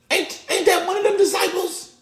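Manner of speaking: very high, loud put-on voice; suspicious onlooker. Onlooker by the fire pointing out Peter, high-pitched accusation. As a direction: squawk an accusing question.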